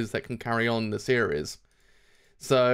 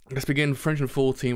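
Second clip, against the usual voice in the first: ordinary talk, nothing marked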